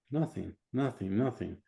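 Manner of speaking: soft, unconvinced client's reply; hesitant answer. Acted. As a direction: answer softly, not quite believing it. Client still doubts the answer.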